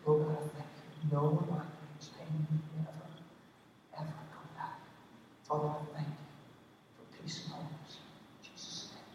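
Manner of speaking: soft, near-whispered prayer; a hushed prayer for a stranger with migraines; quiet prayer. Shown as a demonstration. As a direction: murmur a gentle healing prayer. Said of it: "You might say".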